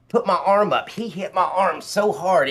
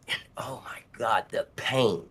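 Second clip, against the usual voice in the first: breathless, much softer gasp of pain; pained whisper; 2 semitones lower, 6 dB quieter than usual. Real, not acted. Remembering sharp chest pain.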